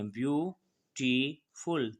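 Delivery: Beautiful very slow, split into ब्यू-टी-फुल; slowed to show syllables. Shown as a demonstration. Teacher to class, pulling beautiful apart into syllables, very slowly. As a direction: stretch beautiful out so each of its three syllables is heard. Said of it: "तीन syllabic हैं".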